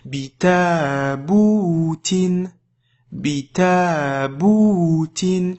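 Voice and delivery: "bita boutin" very slow, ta and bou held long; rhythmic prolonged reading. Shown as a demonstration. Teacher showing a reading rhythm, "bita boutin" with drawn-out long vowels. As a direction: give "bita boutin" a measured flow, long vowels sustained, short ones brief. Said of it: "regarde comment je donne un rythme".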